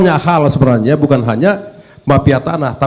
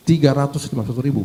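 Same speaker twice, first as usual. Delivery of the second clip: "Tiga ratus lima puluh satu ribu" rattled off fast and softer; fast, softer echo of the figure, letting it sink in; quick quiet repeat; 2 semitones lower, 7 dB quieter, much faster than usual. Real, not acted. Legislator repeats the case count to the minister, fast and soft.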